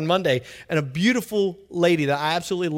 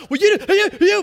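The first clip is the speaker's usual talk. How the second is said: mock outburst; 12 semitones higher, 7 dB louder than usual. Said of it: "it's not".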